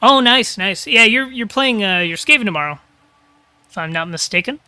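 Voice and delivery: ordinary talk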